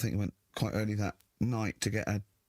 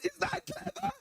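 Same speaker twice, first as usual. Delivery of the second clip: shrill, near-sobbing outrage, much higher; berserk teacher. Retold or imitated. Teacher showing pupil's rude book, wailing high outrage. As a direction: whine it out in despair. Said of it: "he went berserk".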